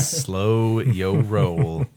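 stretched out slowly, playful; slow and drawn out. Acted. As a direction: stretch every word, teasing. Teasing a friend to calm down.

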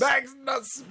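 high-pitched shouted call; exaggerated high cry; mock shouted name. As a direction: yell the name out theatrically.

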